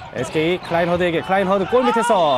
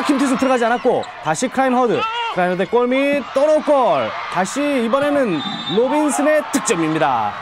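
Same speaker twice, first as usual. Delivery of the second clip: excited play-by-play shout; 6 semitones higher than usual. Real, not acted. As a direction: call it like the crowd's on its feet. Commentator calls a scramble under the basket.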